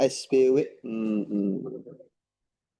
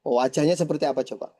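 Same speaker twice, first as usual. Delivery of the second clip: ordinary talk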